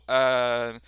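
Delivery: usual speaking voice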